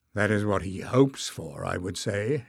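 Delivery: plain reading voice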